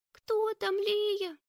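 little sister peeking out, afraid; frightened child voice. Acted.

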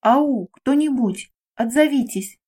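ordinary delivery, nothing marked

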